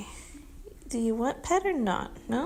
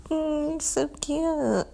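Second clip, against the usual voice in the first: ordinary delivery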